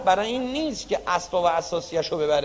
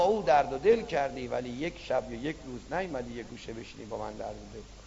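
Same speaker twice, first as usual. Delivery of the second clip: soft reproach; 8 semitones lower, 10 dB quieter, faster than usual. Acted.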